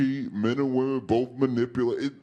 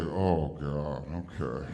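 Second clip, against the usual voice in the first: resigned; 8 semitones lower, 5 dB quieter than usual. Acted. Resigned watching others celebrate.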